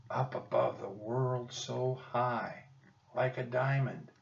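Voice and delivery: soft, slow hushed prompt, lips barely moving; whispering. Acted. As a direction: slip him the line under your breath. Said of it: "Jack whispered".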